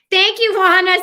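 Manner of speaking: calling out a delighted thank-you; excited thanks. Real, not acted. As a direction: shout out a happy thank-you to a fan.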